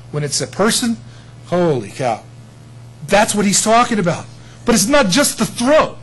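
ordinary delivery

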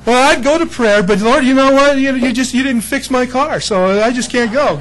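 mock whining. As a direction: whine like a sulky churchgoer. Whiny believer voiced.

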